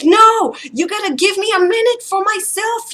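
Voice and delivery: re-enacted frazzled parent's outburst; angry retort. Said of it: "I was still so angry".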